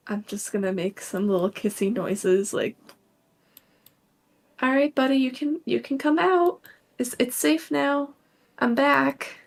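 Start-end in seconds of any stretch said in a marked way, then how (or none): 4.6-9.4 higher-pitched, coaxing reassurance; gentle coaxing. Acted. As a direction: coax someone timid out of hiding, voice raised high. Coaxing someone hidden to come out.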